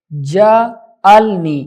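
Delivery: جعلنی stretched out slowly, syllable by syllable; slow drawn-out syllables. Shown as a demonstration. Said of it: "جیم حالانکہ ساکن ہے". (جعلنی کو آہستہ کھینچ کر)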